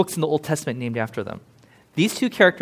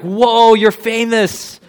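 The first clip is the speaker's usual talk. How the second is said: higher, louder, hammed-up awe; mock starstruck gush; 6 semitones higher, 7 dB louder than usual. Mock fan gushing over relative.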